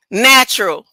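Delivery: ordinary talk, nothing marked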